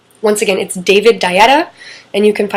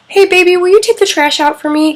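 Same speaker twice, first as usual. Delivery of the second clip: sweet wife; 9 semitones higher, 3 dB louder than usual. Retold or imitated. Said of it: "she says".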